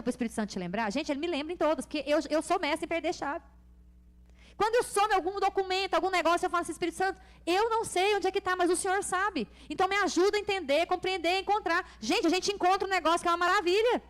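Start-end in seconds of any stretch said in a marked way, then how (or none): 4.6-14.0 high, driving sermon delivery; raised, urgent preacher's voice; fervent preaching. Real, not acted. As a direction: build conviction and press it on the congregation. Preaches at full force.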